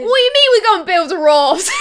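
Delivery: a bad Cockney, loud, breaking into a laugh; terrible Cockney accent. Saeed's line redone in a terrible Cockney accent, loud and laughing. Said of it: "That's my terrible Cockney accent for you".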